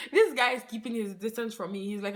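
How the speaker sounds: usual speaking voice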